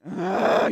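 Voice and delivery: loud, drawn-out groan of exasperation; exasperated groan. Real, not acted. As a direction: groan loudly, fed up. Fed up with repeated meme.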